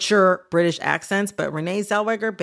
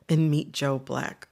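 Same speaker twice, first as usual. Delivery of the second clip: ordinary talk, nothing marked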